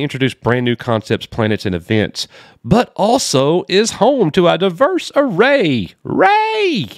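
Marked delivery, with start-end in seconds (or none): 2.6-6.9 high, slow, drawn-out mock proclamation; hammed-up high voice, climbing on the last word; mock grandeur. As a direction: stretch it into a silly soaring fanfare. Mocking the article's grand claim.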